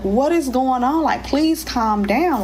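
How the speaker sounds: begging her to calm down while panicking too; panicked pleading. Retold or imitated. To the screaming cousin on the phone, high, pleading.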